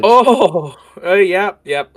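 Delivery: high, loud sudden "oh!"; sudden realization. Real, not acted. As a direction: it just clicked. GM suddenly remembers the letter.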